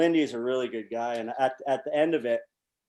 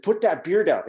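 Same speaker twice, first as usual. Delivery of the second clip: raised, higher urgent order; urgent command; 5 semitones higher, 6 dB louder than usual. Retold or imitated. Player warning teammate about the approaching coach, urgent raised voice. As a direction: snap the order at him.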